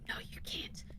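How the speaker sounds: hushed firm no; whispered doctor refusal. Retold or imitated. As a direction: deny her softly in a whisper. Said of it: "And he's like".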